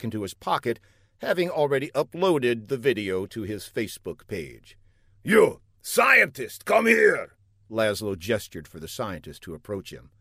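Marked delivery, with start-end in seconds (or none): 5.2-7.4 high, loud summons; commanding villain. A narrator's reading. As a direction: bark the order at the scientist.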